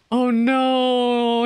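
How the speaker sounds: normal talking voice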